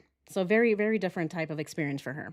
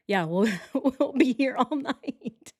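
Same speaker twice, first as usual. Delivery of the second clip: laughing; 3 semitones higher, slower than usual. Real, not acted. Laughing at absurd evidence list.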